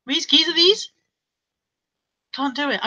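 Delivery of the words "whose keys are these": halting mock Wearside accent; Sunderland accent. Shown as a demonstration. Testing the phrase for viewers, Sunderland accent. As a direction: keep trying a Sunderland accent. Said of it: "Sunderland accent".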